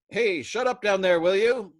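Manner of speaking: loud, high holler. A narrator's reading. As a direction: holler across the ward. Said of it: "Patients all over the ward began hollering".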